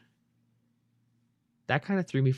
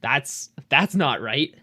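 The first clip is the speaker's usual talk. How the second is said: mock shock; 2 semitones higher, 5 dB louder, slower than usual. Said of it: "and I'm like".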